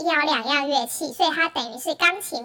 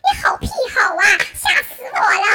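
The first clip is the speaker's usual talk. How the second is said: mock outraged thought; 5 dB louder than usual. Retold inner reaction, shocked.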